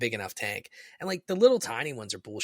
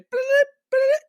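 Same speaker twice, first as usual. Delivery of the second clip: very high, loud imitated electronic beep; high loud beeping mimic; parrot beep; 9 dB louder than usual. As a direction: squawk out the phone beeps like the bird. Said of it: "And he's like".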